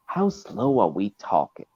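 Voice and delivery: measured Southern drawl. Shown as a demonstration. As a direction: draw it out in a Southern accent. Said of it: "I gotta talk a bit slower".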